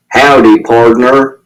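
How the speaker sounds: a jokey, low cowboy hello; joking cowboy greeting. A narrator's reading. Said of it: "which is a joke he does".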